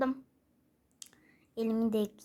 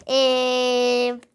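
ordinary talk

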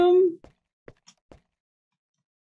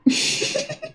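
startled laugh; 5 dB quieter than usual. Real, not acted. After asking not to be scared.

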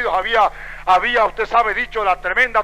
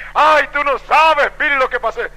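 excited exclamation; 8 semitones higher, 6 dB louder than usual. Retold or imitated. Wife phoning her husband with news, excited outburst. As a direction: burst out with amazing news, imitating the wife.

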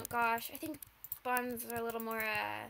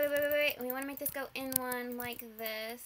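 usual speaking voice

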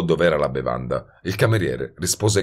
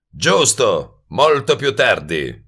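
slow, higher-pitched musing; ruminating; 6 semitones higher, 4 dB louder, slower than usual. A narrator's reading. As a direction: chew slowly over each word. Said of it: "disse ruminando". (tono rimuginante, lento)

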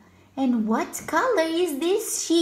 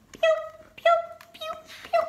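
bird chirp; 12 semitones higher, much faster than usual. Pretend. Bird sound in picture book.

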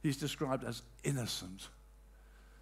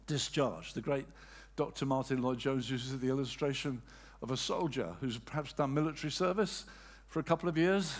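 ordinary delivery

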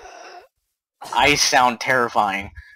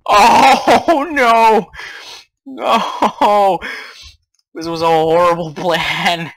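high, loud wail breaking into laughter; panicked wail; 7 semitones higher, 10 dB louder, slower than usual. Real, not acted. Player whose horrible plan fails, panicked wail.

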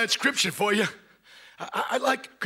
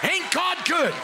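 higher and higher, testifying in a shout; fired-up believer; 7 semitones higher than usual. Retold or imitated. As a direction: build it like a believer who can't hold it in. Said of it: "I'm gonna find me someone say".

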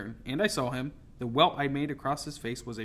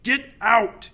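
high, very loud furious order; raging; 9 semitones higher, 12 dB louder than usual. A narrator's reading. Captain driving the girl from his cabin, raging. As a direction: bellow it in a rage, drawing it out. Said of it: "he raged".

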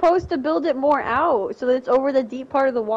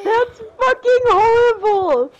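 laughing outrage; 10 semitones higher, 5 dB louder, slower than usual. Real, not acted. Mock outrage while laughing.

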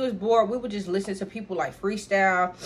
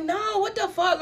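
upset outburst; 12 semitones higher than usual. Retold or imitated. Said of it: "she was like".

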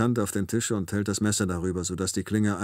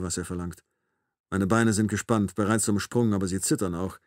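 ordinary delivery, nothing marked

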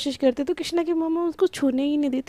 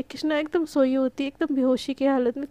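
usual speaking voice

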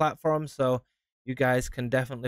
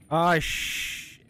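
normal talking voice

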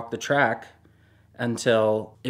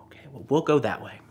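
quick hushed whisper; faster than usual. Retold or imitated. To her husband, sneaking past the guard, in a quick whisper. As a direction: whisper it fast so nobody hears.

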